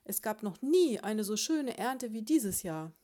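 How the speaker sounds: loud, emphatic denial; heated, boastful contradiction; irritated yell. A narrator's reading. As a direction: insist at full voice. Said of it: "schrie Ambrosius Dauerspeck".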